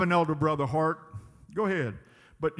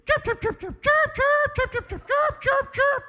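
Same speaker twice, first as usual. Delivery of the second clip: very high chirping birdcall; squeaky bird imitation; bird chirps; 5 dB louder than usual. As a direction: chirp like hungry birds at the feeder. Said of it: "they'll let me know".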